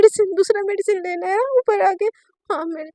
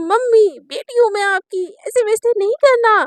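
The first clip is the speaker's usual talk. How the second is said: high, louder, whiny mimicry; mocking imitation of daughter; 5 semitones higher, 7 dB louder than usual. Mother mimicking Naina's whine to tease her, mocking. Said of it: "mummy बोलती कि".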